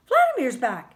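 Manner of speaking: happy, proud burst; beaming. A narrator's reading. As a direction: share the good news with a beam. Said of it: "she beams".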